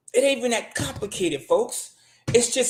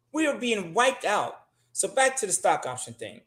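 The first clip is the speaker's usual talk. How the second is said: ordinary talk, nothing marked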